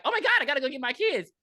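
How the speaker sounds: high, fast, urgent blurt; mock panicked mom. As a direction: imitate a mother rushing to her kids. Voicing panicked mom rushing off.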